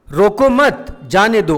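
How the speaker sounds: रोको मत together, then a break before जाने दो; pause after मत. Shown as a demonstration. Teacher repeats the permission sentence, pausing after मत. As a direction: keep मत with रोको and let जाने दो follow separately. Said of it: "रोको मत के बाद विराम चिन्ह लगाया".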